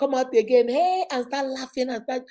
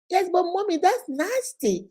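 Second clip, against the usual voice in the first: imitating her little son; 8 semitones higher than usual. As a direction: play the cheeky little boy insisting. Son answers back in story.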